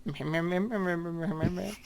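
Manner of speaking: high imitation of a drunk mumble. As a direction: copy the drunk "me me me" noise. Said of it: "When he speaks drunk".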